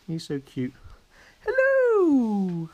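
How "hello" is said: sing-song hello. Real, not acted. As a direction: call it up high like a cheerful chime. Greeting the waking baby.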